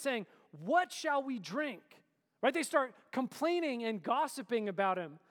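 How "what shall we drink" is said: slow, higher, louder mock complaint; grumbling complaint. Preacher voicing Israelites grumbling at Moses, slow whine. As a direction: draw it out like a whiny crowd. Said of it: "the people grumbled against Moses".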